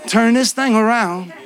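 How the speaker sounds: slow, pleading prayer to God; earnest prayer. Retold or imitated. Preacher praying aloud to God, slow and pleading. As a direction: plead with God slowly, each "turn it around" heavier. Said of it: "I'm praying".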